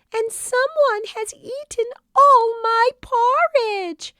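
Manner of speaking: very high, loud little cub squeak; squeaky Baby Bear. A narrator's reading. Baby Bear squeaks about his porridge. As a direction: keep the tiny bear's squeak going.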